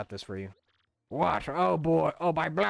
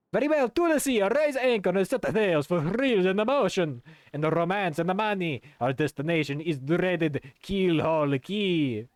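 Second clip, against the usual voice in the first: higher, louder rousing order; rally the crew to sail; grand captain's call; 4 semitones higher, 4 dB louder than usual. Acted. Old captain ordering the crew to sea, rousing. Bobbery grand departure speech.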